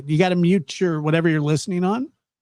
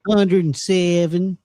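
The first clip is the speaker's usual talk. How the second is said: Texan voice; 3 semitones higher, much slower than usual. Retold or imitated. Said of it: "do that voice again".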